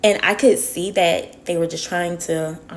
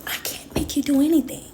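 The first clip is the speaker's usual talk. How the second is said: whispered aside; 8 semitones higher, faster than usual. Real, not acted. Calm aside after a rant.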